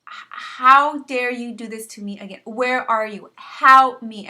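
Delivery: building to an all-out yell: how dare you, where are you, help me; desperate yell at God. Retold or imitated. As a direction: cry out to God from the top of your lungs. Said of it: "I was yelling from the top of my lungs".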